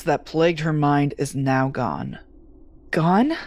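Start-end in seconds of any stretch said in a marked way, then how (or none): 2.9-3.4 sharp high alarmed questions; startled and confused; shocked disbelief. Acted. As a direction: catch her off guard with the news. Shocked at the patient's fate.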